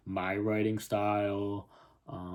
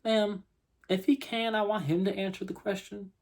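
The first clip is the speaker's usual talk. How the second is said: higher-pitched, lightly acted lawman; interjecting policeman; 10 semitones higher than usual. Policeman asks the boy himself, higher interjecting voice. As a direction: step in, pitched well above the narration.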